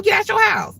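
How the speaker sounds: high, loud command; rebuking the devil. Shown as a demonstration. Preacher showing listeners how to order the devil out, loud. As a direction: throw the devil out hard. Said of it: "tell him".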